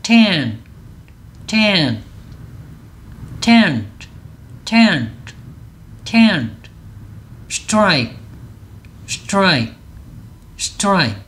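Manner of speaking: tent and strike each as one falling syllable; model pronunciation. Shown as a demonstration. Teacher leads learners in sounding words together, model pronunciation. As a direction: say "tent" and "strike" singly, crisp English. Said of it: "같이 소리를 한번 내볼게요".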